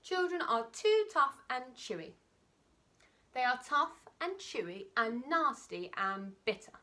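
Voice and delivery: high, slow, prim little voice; small crocodile objecting, high and slow; timid little crocodile. Acted. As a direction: squeak it out as the small, picky croc. Not so big croc's voice.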